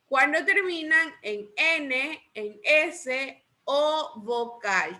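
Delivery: slowing to drawn-out, separated words; slow drilled answer. Shown as a demonstration. Teacher gives the class the answer, slowly drawn out. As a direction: say it slowly, stretching each final item.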